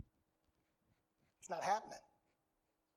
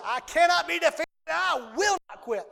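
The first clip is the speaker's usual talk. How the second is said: loud, raised-pitch emphatic declaration; imitated forceful shout, punching CANNOT and WILL; emphatic Pastor Hagen; 6 semitones higher, 5 dB louder than usual. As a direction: shout it like a defiant preacher making a vow. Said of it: "That's how he says it. He's very emphatic about it".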